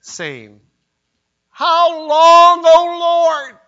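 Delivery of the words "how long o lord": high, loud wail to heaven; loud cry. Acted. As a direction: cry it out across the room. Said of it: "they cried with a loud voice".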